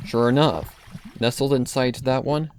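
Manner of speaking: plain reading voice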